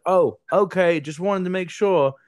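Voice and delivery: lightly voiced high breezy okay; a light Aussie lilt; Aussie guy. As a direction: shrug it off innocently. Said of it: "he was like".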